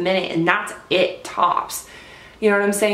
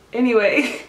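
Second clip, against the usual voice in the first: usual speaking voice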